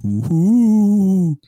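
high, loud ghostly ooh; spooky ghost moan. Acted. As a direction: wail it like a ghost. Spooky host voice.

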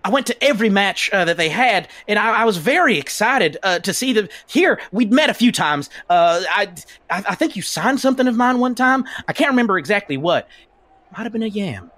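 put-on southern accent. [name] greeting Ref as a fan, southern accent. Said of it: "I just really like your southern accent".

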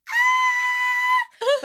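shrill, loud mock scream; hammed-up kid screaming his head off; imitated child scream. Boy screaming in the back seat, imitated scream. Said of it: "just screaming".

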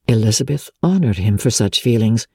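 ordinary delivery

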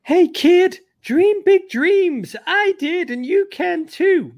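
very high, slow, loud mimicry; William Perry. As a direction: stretch it out as hammed-up encouragement to a kid. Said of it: "and he goes".

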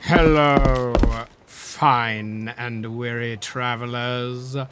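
low, slow, grandly theatrical host; posh gentleman voice. Acted. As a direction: welcome guests like a pompous aristocrat. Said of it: "I am already playing roguish theatrical upstart investor".

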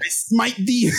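high, booming divine voice; mock biblical prophet. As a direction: play a wrathful god, hammed up. Mock God punishing phone.

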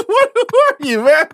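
высоко сквозь смех; не веря своим ушам, со смехом; laughing disbelief. Real, not acted. Laughing disbelief at fart apology.

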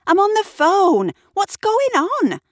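high, loud, outraged call; annoyed yell. A narrator's reading. Kat flings open her door mid-call, yelling crossly. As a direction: burst out indignantly over the racket.

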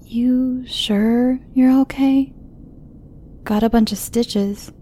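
put-on high, slow, concerned young girl; lightly voiced concerned girl. A narrator's reading. Girl studying the stitched driver, slow and concerned. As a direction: lean in and check on him gently.

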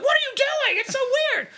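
very high weirded-out wife. Retold or imitated. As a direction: squeak it out, very high. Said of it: "And she's like".

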